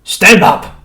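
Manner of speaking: high, loud barked order; rough showman's shout; loud command. A narrator's reading. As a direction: shout it down at him. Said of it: "said the shopkeeper loudly".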